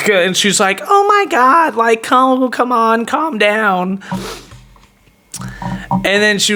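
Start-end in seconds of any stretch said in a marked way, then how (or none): 0.8-4.0 high start, settling as it goes; imitated exasperated woman. Woman trying to calm two men, exasperated imitation. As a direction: mimic her exasperated pleading, pitched up.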